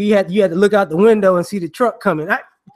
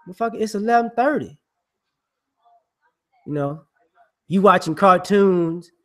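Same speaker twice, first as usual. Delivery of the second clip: ordinary delivery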